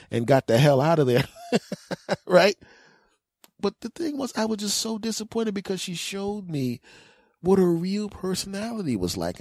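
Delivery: normal talking voice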